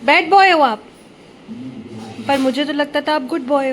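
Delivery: mother playing shocked at her son's reply; mock surprise. Real, not acted. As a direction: pretend to be shocked. Mother reacting to child's answer.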